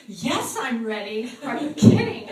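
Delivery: bursting with readiness; high shout of yes with a laugh; eager yes. Retold or imitated. Woman eager to be prayed for.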